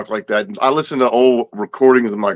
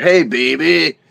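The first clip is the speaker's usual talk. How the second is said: put-on New Orleans accent; 5 semitones higher, slower than usual. Guest mimicking people in New Orleans, playful local accent. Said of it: "like the people in New Orleans".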